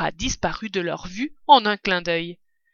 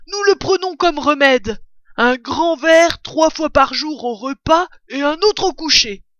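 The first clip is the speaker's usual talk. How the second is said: loud, raised badger voice; enthusiastic badger; 7 semitones higher, 8 dB louder than usual. A narrator's reading. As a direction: proclaim it high and hearty. Voice of a story figure. (blaireau enthousiaste, voix haute et forte)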